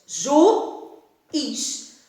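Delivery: Juiz split slowly into ju and is; syllable by syllable. Shown as a demonstration. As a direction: pull Juiz apart into two syllables with a clear gap. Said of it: "quais são as sílabas?". (Juiz separado em sílabas: ju, is)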